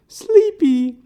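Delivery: goofy high-pitched name call; calling Sleepy. Retold or imitated. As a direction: sing out the nickname in a silly high voice. Calling out the nickname.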